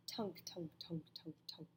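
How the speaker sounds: quiet, creeping tunk sounds fading away; hushed menacing tunk, tunk; soft sinister tunks. A narrator's reading. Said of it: "the soft, sinister".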